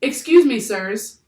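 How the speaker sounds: high, loud opening call; shouted interruption. A narrator's reading. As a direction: shout for the men's attention. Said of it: "he shouted".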